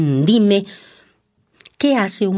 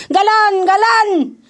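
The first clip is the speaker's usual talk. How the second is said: high calling shout; 14 semitones higher, 3 dB louder than usual. Acted. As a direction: call out across the ring. Calling out to the gallant.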